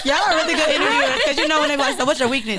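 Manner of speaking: teasing the interviewers through laughter; laughing tease. Real, not acted. Guest laughing at the sneaky weakness question.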